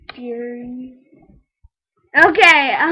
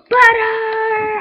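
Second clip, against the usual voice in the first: excited shout; 8 semitones higher, 12 dB louder than usual. Real, not acted. Spots butter in game, gasps.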